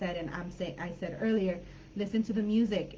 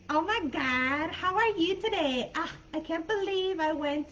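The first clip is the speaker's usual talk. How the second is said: put-on Valley Girl accent; 8 semitones higher than usual. Shown as a demonstration. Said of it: "uh, Valley Girl accent".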